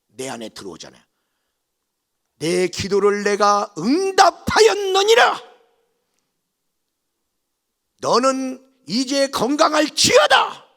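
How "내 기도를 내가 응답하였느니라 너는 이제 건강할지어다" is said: slow, very loud decree; booming divine proclamation. Acted. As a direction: proclaim it like God answering, loud and drawn out. 들어온 하나님 말씀을 선포. (크고 느리게 외치는 선포)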